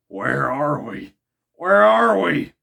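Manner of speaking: loud, slow, rising alarm; Ned cries out in bewildered alarm; crying out. Acted. Ned Land shouts at the marvels, alarmed. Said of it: "cried out the Canadian".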